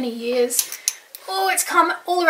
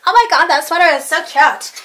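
put-on loud, gushing American accent; running-joke impression of an excited American; 3 semitones higher, 6 dB louder than usual. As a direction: mimic an American girl's over-the-top compliment. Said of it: "It's like we always say".

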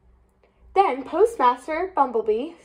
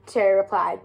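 plain reading voice

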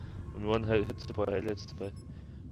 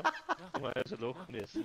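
normal talking voice

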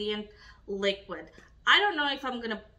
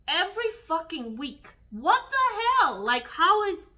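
exasperated outburst; 6 semitones higher, 5 dB louder than usual. Real, not acted. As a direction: voice jumps up high and loud in disbelief. Ranting about hauls.

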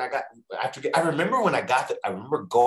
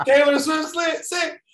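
very high, loud mock shout; squealed punchline; 6 dB louder, slower than usual. Repeating own excuse to boss after laughter, squealed. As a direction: shriek the excuse as a comic punchline.

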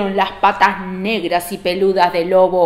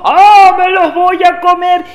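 loud, raised gleeful roar; pouncing wolf; 8 semitones higher, 9 dB louder than usual. Acted.

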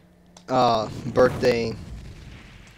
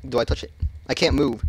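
normal talking voice

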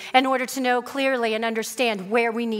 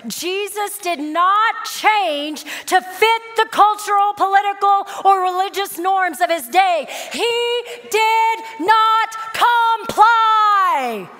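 higher, louder, slowing on the key words; full-force preaching; 6 semitones higher, 5 dB louder, slower than usual. Acted. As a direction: thunder it out to the congregation.

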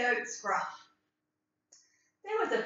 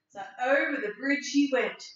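ordinary delivery

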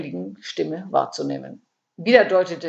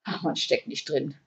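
softer, dramatic sigh of conclusion; 3 semitones lower, 6 dB quieter than usual. A narrator's reading. Käthe sums up her misfortune, quietly dramatic. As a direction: drop the voice for a dramatic close. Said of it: "schloss sie dramatisch".

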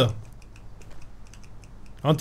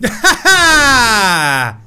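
big laugh into a cheer; triumphant laughter; 4 dB louder, much slower than usual. Real, not acted. Player celebrating the jump, laughing triumphantly. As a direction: laugh out loud in victory.

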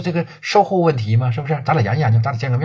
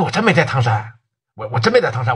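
normal talking voice

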